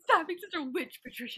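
mock scolding. Imagining a scolding of Patricia, loud mock rebuke. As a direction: scold her in jest.

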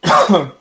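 real cough while choking. Real, not acted. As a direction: cough to clear the choke. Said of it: "I'm choking a little bit".

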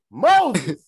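loud hail of a name; calling across the street. Retold or imitated.

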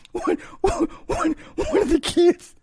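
very high, strained voice wheezing with laughter; laughing so hard it squeaks; retelling while breaking up; crying laughing. Real, not acted. Retelling through near-tears laughter.

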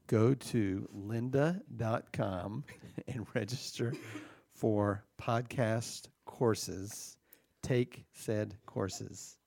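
very slow, halting words; writing-along dictation. Pretend. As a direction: sound out each phrase as you write it. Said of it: "I'm writing now on your head".

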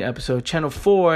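usual speaking voice

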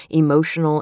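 plain reading voice

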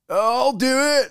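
very high, drawn-out goofy volunteering; mock eager volunteer. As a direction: imitate a guy piping up across the party. Acting out a guy eagerly volunteering.

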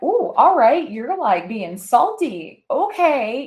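high, loud mock-impressed singsong; teasing. Two players ribbing a sore loser, teasing and high-pitched. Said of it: "they're trying to tease me".

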